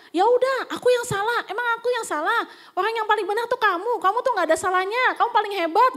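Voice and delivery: high-pitched, heated mock surrender; insincere apology. Husband conceding to his wife, sarcastic insincere apology. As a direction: sarcastically heap praise while taking the blame. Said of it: "minta maaf dengan tulus? Enggak".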